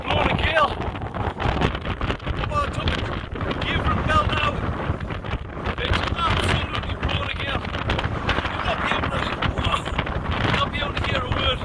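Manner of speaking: high-pitched, straining against the gale; real high-pitched call from a windy hilltop; calling over wind. Real, not acted. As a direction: raise your voice over a howling wind.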